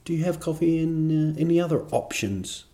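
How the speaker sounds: lightly voiced polite Swedish customer; polite Swede. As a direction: put on a timid, courteous Swede. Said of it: "did the Swedish thing of".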